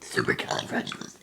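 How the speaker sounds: belched and fading; burped word, breathy. Shown as a demonstration. Trying the long word again, burped. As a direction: belch it until air runs out. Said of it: "super cut first".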